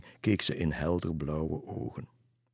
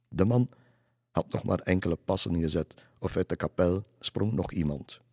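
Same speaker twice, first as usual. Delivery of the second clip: ordinary delivery, nothing marked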